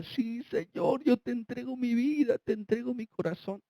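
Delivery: a dying man in hospital, softly tearful, tells the Lord he gives his heart. Retold or imitated. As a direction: voice the weeping man giving his life, softly. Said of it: "ese varón lloraba y decía".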